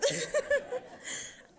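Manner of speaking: breathy high nervous laughter. Real, not acted. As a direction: laugh off the nerves. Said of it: "I especially tend to laugh when I'm nervous".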